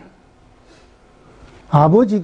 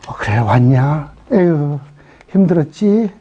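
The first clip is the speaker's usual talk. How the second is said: tender God; 7 semitones lower, slower than usual. Retold or imitated. Gentle God welcoming.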